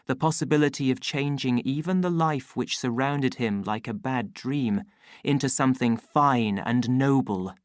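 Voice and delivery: usual speaking voice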